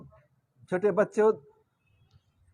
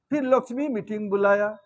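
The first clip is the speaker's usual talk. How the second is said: ordinary delivery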